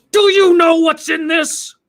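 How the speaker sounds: high, loud indignant demand; mock outraged customer; angry customer. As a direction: confront the clerk, outraged. Angry customer confronting clerk.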